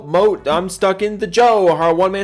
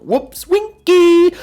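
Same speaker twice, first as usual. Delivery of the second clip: much higher, slow, squeaky silly voice; 14 semitones higher, 7 dB louder, much slower than usual. Acted. Streamer reading a goofy name in a high squeak.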